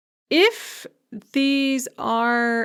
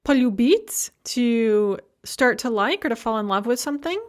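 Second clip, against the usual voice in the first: normal talking voice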